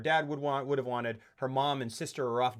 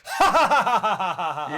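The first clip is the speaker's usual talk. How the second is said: big, forced anime laugh; loud, high, mechanical ha-ha-ha; 5 semitones higher, 9 dB louder than usual. Retold or imitated. Imagined actor dubbing anime, forced laughing.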